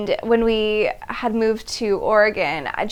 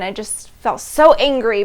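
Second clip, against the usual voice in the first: ordinary talk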